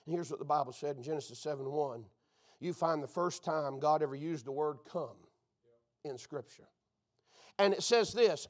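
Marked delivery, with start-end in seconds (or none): 0.1-6.7 much lower and softer, quick; hushed lead-in to scripture; low quiet aside. Real, not acted. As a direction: drop the voice to set up the verse. Aside before reading scripture.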